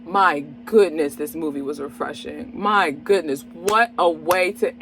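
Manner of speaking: ordinary delivery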